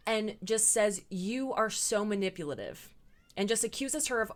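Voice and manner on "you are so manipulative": ordinary talk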